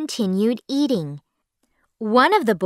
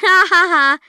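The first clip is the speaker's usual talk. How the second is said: bright spoken laugh, higher and louder; 9 semitones higher, 6 dB louder, faster than usual. A narrator's reading. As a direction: laugh at the bullies. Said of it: "Nina and I laughed".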